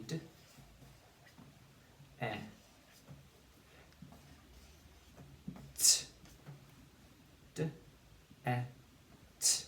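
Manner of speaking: "d", "e", "t" as isolated sounds, long pauses between; sounded out. Shown as a demonstration. Teacher comes back together with pupils, sounded out. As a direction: say "d", short "e", crisp "t" one at a time. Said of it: "And we say".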